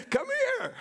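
very high, comic summoning call; retold yell. Husband summoning his wife to see the moon, retold yell. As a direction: re-enact hollering for someone, pitch leaping up. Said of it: "So I yell".

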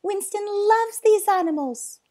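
high, loud, earnest appeal; pleading. Acted. Boy defending zookeeper to owner, pleading. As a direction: plead on his behalf. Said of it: "pleaded the boy".

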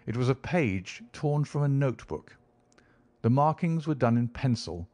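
ordinary delivery

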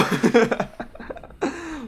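high, tumbling laughter; real laugh after a drop. Real, not acted. Gamer hopping out of the hatch, high laugh.

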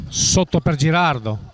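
ordinary talk

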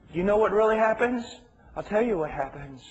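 ordinary talk, nothing marked

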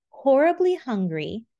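ordinary delivery, nothing marked

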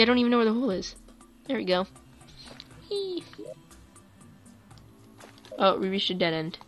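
ordinary delivery

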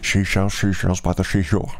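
lightly voiced Scottish Connery slur; Scottish brogue with slushy sh sounds; Sean Connery. Shown as a demonstration. Impressing Sean Connery doing a tongue twister, Scottish lisp. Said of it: "my impression of Sean Connery".

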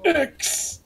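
high strained squeak; dying-sounding. Pretend. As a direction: force it out as if expiring. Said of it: "Why do you sound like you're dying".

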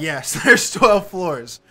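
slow, laughing confirmation. Real, not acted. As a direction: laugh through the words. There's fucking twelve?.

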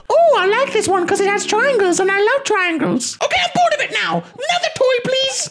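put-on high whiny baby voice. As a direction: whine like a fickle toddler. Baby bored of toy.

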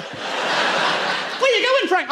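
high, slow, pleading; high pleading call; high mock plea. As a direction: call after him in a high whine.

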